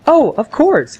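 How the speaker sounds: high mock-friendly clerk voice; imitating coworker. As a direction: coworker cheerfully agreeing, high. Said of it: "she's like".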